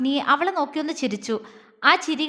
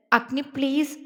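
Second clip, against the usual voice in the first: ordinary delivery, nothing marked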